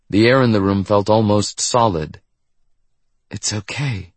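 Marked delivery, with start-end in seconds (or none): 3.3-4.1 soft, gentle reassurance; said softly. A narrator's reading. Doctor to the frozen room, softly. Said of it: "Doctor Avanthikar said softly".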